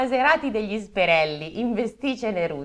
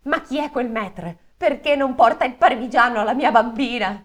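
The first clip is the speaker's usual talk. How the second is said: indignant father; 6 semitones higher, 5 dB louder than usual. Acted. King of steel indignant.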